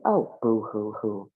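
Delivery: slow, low sarcastic boo-hoo; mock crying. Natasha mocks Paula's complaint, slow sarcastic boo-hoo.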